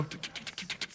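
soft voiceless tongue ticks; light running-feet sound effect; scurrying feet imitation. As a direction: tick out quick footsteps on stairs.

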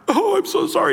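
high wailing apology, hammed-up; overdone tearful sorry; mock weeping. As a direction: pour out a theatrical sob of regret. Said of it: "He's weeping".

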